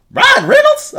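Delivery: very high, loud incredulous question; reliving a startled discovery; shocked disbelief. Retold or imitated. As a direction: squawk the name in astonishment.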